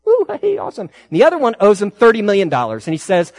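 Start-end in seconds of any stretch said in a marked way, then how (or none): 0.1-0.9 a high whoop of mock delight; mock cheer. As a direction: cheer like the debtor set free. Debtor cheering his forgiven debt.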